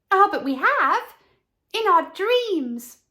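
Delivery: put-on reply like eager dreaming children; children answering. Charity children answering the severe master, high and dreamy. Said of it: "answered the children".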